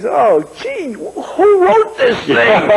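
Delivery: high, drawn-out retold complaint; mock exasperation. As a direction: stretch it out, rising in mock disbelief. Retold groan at a bad film scene.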